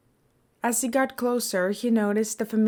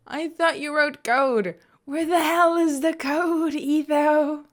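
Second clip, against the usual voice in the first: higher, louder indignant whine; complaining; 8 semitones higher, 4 dB louder than usual. A narrator's reading. B-Dubs to Etho after a confusing class, whiny complaint. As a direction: protest like the lesson was a betrayal. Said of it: "B-Dubs complained".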